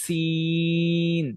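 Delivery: সিন very slow, s through a small gap in the teeth; very slow, drawn-out সিন; sin between the teeth. Shown as a demonstration. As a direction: hold সিন long with the tongue near the teeth. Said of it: "দুই দাঁত যখন আমরা উচ্চারণ করব".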